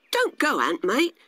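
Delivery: pleading grasshopper. Acted. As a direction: coax a departing mate to stay. Grasshopper urges ant to stay.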